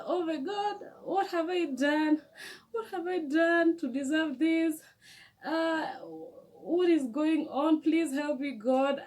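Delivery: high, pleading cry to God; desperate prayer. Retold or imitated. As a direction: beg God for help, near tears. Desperate prayer while crying at airport.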